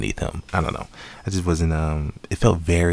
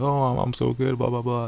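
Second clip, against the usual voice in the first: lightly mimicked show-off, pitched up; higher mock-boastful voice; goofy fraud professor; 7 semitones higher than usual. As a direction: imitate a phony professor bragging. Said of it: "he's acting like".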